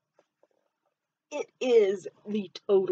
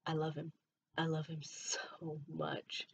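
emotional whisper; 11 dB quieter than usual. Real, not acted. Tearing up about best friend.

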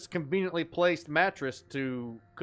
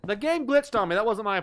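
indignant protest; 5 semitones higher, 5 dB louder than usual. Real, not acted. Protesting game glitch.

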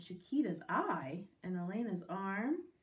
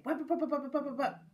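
quick popping running noise; scampering sound effect; 4 semitones higher, 5 dB louder than usual. Acted. Rabbit running away, quick scampering noise. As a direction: rattle off the patter of fleeing feet.

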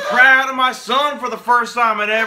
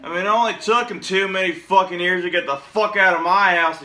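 ordinary talk

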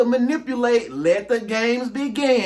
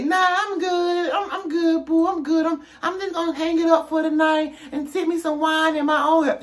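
mock high voice, sweetly turning him down; 8 semitones higher than usual. Answering a caller who wants her over, in a higher, sassy voice. Voicing self turning them down.

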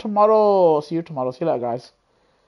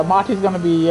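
normal talking voice

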